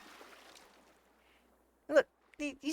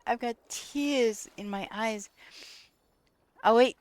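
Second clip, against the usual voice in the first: ordinary talk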